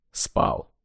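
barely voiced, quiet; sleepy mumble. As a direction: mumble it half asleep. Sleepy agent answer.

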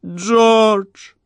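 high piercing shriek. A narrator's reading. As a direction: shriek his name in terror. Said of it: "визжала Кора".

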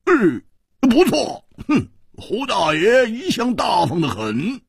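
high, drawn-out boastful declaration; loud bluster. A narrator's reading. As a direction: put on grand bravado, stretching the words. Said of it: "嘴里却大声地说".

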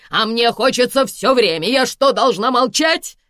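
loud, high tirade; indignant. A narrator's reading. Karina protests her rights as chief mother, indignant. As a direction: rant in outrage, building to a challenge. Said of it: "возмутилась Карина".

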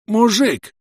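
high, loud enraged yell; furious shout. A narrator's reading. Enemy player yelling from the smoke, furious shout. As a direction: bellow it in rage. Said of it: "бешеный голос донёсся".

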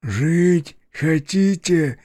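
mumbling through taped mouth; muffled, gagged voice; muffled through tape. A narrator's reading. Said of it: "невнятно промычал я сквозь скотч".

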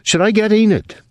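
high, anxious question; worried for her child; frightened. Acted. Jean asking about Enid, frightened. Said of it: "She sounded frightened".

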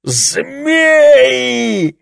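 high, loud shouted growl; furious roar. Acted. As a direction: snarl the word out in fury. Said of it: "прорычал Лесли".

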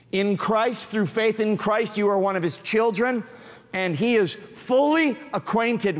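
a preacher urging his flock earnestly; impassioned preaching. Acted. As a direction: press the truth home earnestly.

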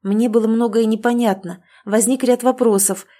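plain reading voice

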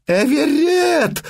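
a long, high howled cry; howled name. Acted. Mother howling for her seized boy, long high wail. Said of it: "взвыла Матрона".

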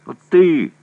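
high, loud call at the prisoner; shout. A narrator's reading. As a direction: bark it out, cocky. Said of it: "крикнул он пленному".

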